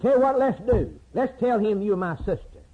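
high-voiced acted suggestion; preacher playing a husband's hasty plan; eager scheming. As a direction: pitch a bright idea, voice up high. Abraham pitches scheme to Sarah.

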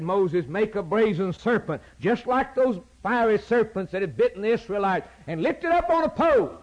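high, rising preaching voice; a hint of God's command. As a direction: announce it from on high.

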